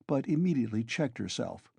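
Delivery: usual speaking voice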